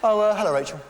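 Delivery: high, loud faux-casual hello; casual greeting. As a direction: act surprised to see her, coolly.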